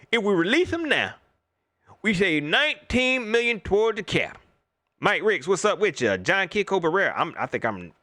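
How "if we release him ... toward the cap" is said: raised, lightly mocking voice of the fans; mocking the fans' argument. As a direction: parrot the fans' cap logic a bit higher. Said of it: "That's what everybody was saying".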